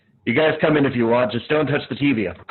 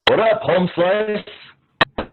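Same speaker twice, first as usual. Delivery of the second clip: put-on slacker teen voice; 6 semitones higher, much slower than usual. Teen greets with slang.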